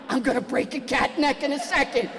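usual speaking voice

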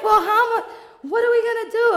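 high, loud worried friend; the friend catching the panic; laughing mock-frantic friend; panicky friend.

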